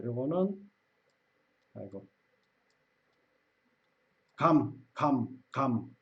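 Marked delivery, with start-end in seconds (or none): 4.4-6.0 감 said slowly, lips closing on the final m; slow pronunciation demo. Shown as a demonstration. Teacher demonstrating the final m of 감 to learners, slow and careful. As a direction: model 감 with a held final m. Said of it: "그쵸?".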